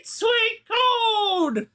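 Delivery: high, slow prospector wail over treasure; old-timey prospector voice. Acted. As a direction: drag it out like a miner finding gold. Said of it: "an old-timey prospector voice".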